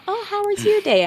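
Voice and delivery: high, sing-song "how was your day"; fake-friendly chit-chat; mock polite small talk.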